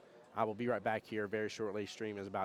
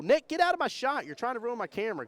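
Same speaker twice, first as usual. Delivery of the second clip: much higher, louder call-out; real half-joking shout, high and loud; playful yell; 12 semitones higher, 9 dB louder than usual. Real, not acted. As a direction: shout across the room at him. Camera operator yelling at friend.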